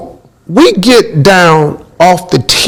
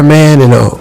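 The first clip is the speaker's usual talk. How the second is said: ordinary delivery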